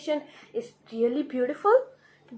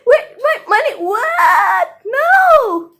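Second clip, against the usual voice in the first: high, loud cries of disbelief; panicked protest; 10 semitones higher, 12 dB louder, slower than usual. Acted. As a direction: yell after someone who is getting away, building to a wail. King left unpaid, protests.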